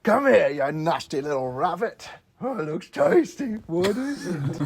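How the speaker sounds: put-on game troll impression. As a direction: play the nasty troll sizing up food. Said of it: "you have to hear him".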